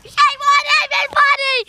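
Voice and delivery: yelling out loud in protest; shouting. Real, not acted. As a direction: shout it, upset at losing. Kid shouting at game loss.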